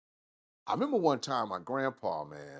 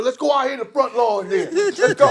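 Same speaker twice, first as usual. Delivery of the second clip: shouted high and loud, rising on go; fired-up shout; 12 semitones higher, 10 dB louder than usual. Retold or imitated. Nephew accepts the uncles' fight challenge, fired-up shout. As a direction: bring it, full hype.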